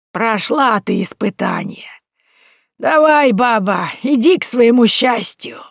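put-on high, slow, loud sage-like old woman; knowing old woman. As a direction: hand down a prophecy, slow and emphatic. Old woman dismisses her kindly.

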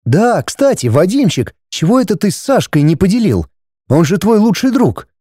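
high-pitched, curious mother voice; mother questioning son. A narrator's reading. Mother asking son about the quarrel, curious. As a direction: switch briskly to quizzing the boy. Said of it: "Моментально переключилась Светлана на сына".

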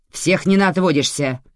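sharp. A narrator's reading. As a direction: brush the request off sharply. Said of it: "ответила резко та".

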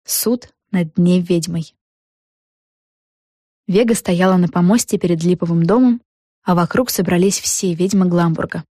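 ordinary delivery

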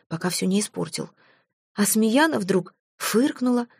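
ordinary delivery

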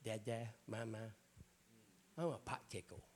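a hint of a small child lisping; childish babble. Preacher imitating a teenager who still talks like a toddler. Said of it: "And she's still".

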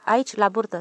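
plain reading voice

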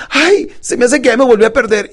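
high, loud imitated lament; mock dismay. Client realising she is lost, mock dismay. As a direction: exclaim like a flustered client.

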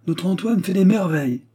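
a touch of proud satisfaction, pitched high. A narrator's reading. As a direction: let a little pride show through. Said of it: "d'un ton où perçait une pointe d'orgueilleuse satisfaction". (avec une pointe d'orgueilleuse satisfaction)

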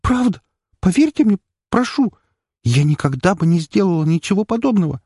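high, begging, frightened; desperate pleading. A narrator's reading. Frightened girl begs the count to believe her. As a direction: beg them to believe you.

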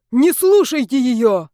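loud shout. Acted. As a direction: yell at the class. Isar leaps up accusing.

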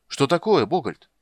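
high, loud, falling at the end; shouted question. A narrator's reading. Bard asks Bogholt what he sees, crying out. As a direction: press eagerly for an answer. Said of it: "крикнул Лютик".

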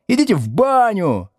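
high-pitched jeer from the back desks; jeering pupils. Acted. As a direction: yell it like a rude schoolkid. Back desks keep yelling at teacher.

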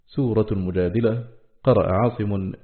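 ordinary delivery

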